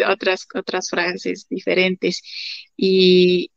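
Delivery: ordinary talk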